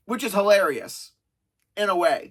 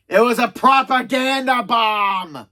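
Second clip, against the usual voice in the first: hammed-up indignant yell; mock outraged shout; 4 dB louder than usual. Acted outburst after leaflets scatter.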